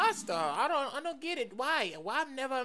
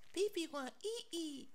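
squeaky pet voice; 6 semitones higher, 8 dB quieter than usual. Acted. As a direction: talk like a tiny pet. Pet baby talk.

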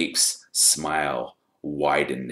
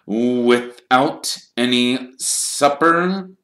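higher, louder, slow disbelief; triumphant Snape; 9 semitones higher, 9 dB louder, much slower than usual. A narrator's reading. Snape catching Harry in a lie, slow and sceptical. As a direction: stretch each word, savouring the catch.

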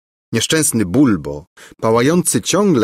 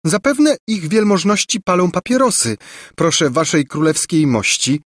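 lightly voiced servant girl, higher; timid maid; 8 semitones higher than usual. A narrator's reading. Maid answering the king, timid.

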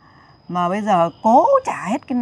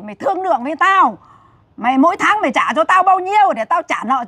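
hard, loud demand. Real, not acted. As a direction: call out the absent debtor with mounting force. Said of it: "bây giờ phải cứng".

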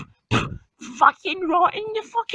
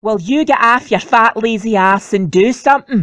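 much lower, louder mum voice, nagging; 10 semitones lower, 7 dB louder than usual. Acted. Mum nagging her lazy husband, low loud voice.

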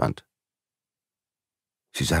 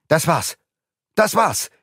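excited, raised shout; calling out; 9 semitones higher than usual. Acted. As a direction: cry out in triumph. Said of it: "rief ein Diener".

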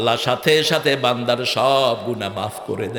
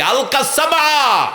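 1 emphatic shout; 12 semitones higher, 5 dB louder, much slower than usual. Acted. Shouting key Quranic phrase.

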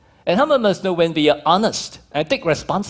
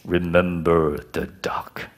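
low, slow breathy whisper; 9 semitones lower, slower than usual. Acted. Sister secretly reminding her brother, whispered. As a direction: whisper the threat right into his ear. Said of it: "Susan whispered to the ear".

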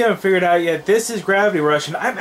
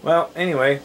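usual speaking voice

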